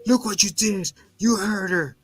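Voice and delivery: hammed-up boyfriend chasing him off; angry jealous boyfriend. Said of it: "Jim is like".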